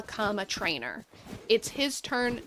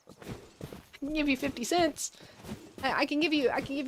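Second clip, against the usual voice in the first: coaxing bribe; 4 semitones higher than usual. Acted. Bargaining with viewers.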